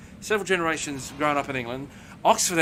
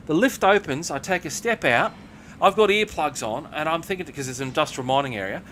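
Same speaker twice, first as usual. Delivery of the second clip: ordinary talk, nothing marked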